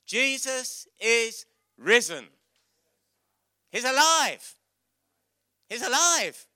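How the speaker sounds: high, loud, slow announcement; joyful proclamation. Acted. Preacher proclaiming resurrection to children, jubilant. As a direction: declare it like the best news ever.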